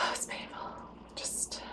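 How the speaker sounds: whispered with a sigh. Real, not acted. Vlogger recalling awkward dates with a sighed whisper. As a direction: breathe it out, worn down.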